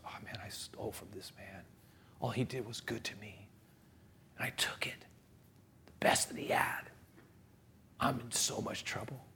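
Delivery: hushed, whispered inner confession; guilty whisper. Acted. Thief facing the bishop he robbed, guilty whisper. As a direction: whisper it to yourself, full of dread.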